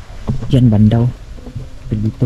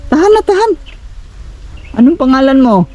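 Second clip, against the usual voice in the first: raised, questioning call; 12 semitones higher than usual. Real, not acted. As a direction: call out across a distance, voice raised high. Calls out, soothing crying child.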